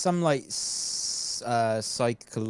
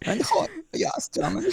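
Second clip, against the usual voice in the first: put-on German accent. Repeats the German voice.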